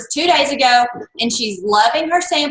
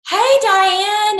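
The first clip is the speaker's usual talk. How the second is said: high, chirpy retold hello; bright greeting; 8 semitones higher than usual. Man greeting the sales manager at checkout, cheery. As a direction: imitate a friendly call to an acquaintance.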